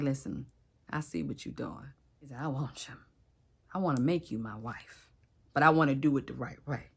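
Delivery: low, hushed, intimate voice; soft, serious suitor. Retold or imitated. Boaz telling Ruth he wants her as wife, soft and serious.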